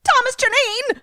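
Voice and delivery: high, loud name call; hammed-up cry of a name; mock alarmed call. Shouting the character's name.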